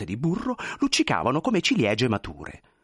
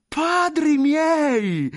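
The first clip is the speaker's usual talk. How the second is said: high, loud, long-drawn cry; hugely stretched gasp-like shout of surprise; astonished exclamation; 6 dB louder, much slower than usual. Acted. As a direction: cry out in amazed delight. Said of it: "stupì lo smilzo".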